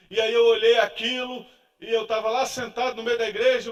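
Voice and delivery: normal talking voice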